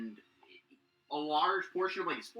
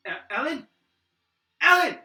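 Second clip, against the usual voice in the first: calling a name, rising to a loud high cry; husband crying out to his captured wife; desperate call; 15 dB louder than usual. Acted. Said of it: "He's like".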